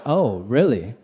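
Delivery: low, unimpressed "oh, really"; offended but keeping it low; put out. Retold or imitated. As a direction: drop low, coolly put out. Comedian put out by brush-off.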